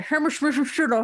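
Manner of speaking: squashed, hard-to-follow London pronunciation of Hammersmith and City; mumbled London accent. Retold or imitated. Mimicking the Underground stranger's thick London accent. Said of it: "it sounded like he said".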